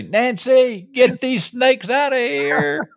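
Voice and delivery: high, slow mock-panicked yell for Nancy; hammed-up fright, calling for help; panicked call to wife. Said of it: "I'd say".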